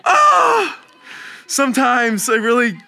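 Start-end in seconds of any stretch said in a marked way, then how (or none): 0.0-0.8 loud, high cry of frustration; exasperated yell. Acted. Preacher recalling his own mistake, exasperated yell.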